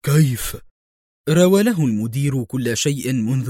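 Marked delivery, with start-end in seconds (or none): 0.1-0.6 alarmed. A narrator's reading. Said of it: "في انزعاج".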